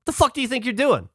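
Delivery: high-pitched retold challenge from an angry guard; aggressively angry. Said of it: "aggressively angry at us".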